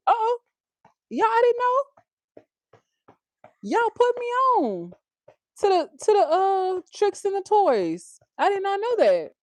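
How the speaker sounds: excited surprise. Real, not acted. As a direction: gasp it out, genuinely amazed. Learns about dollar store toys.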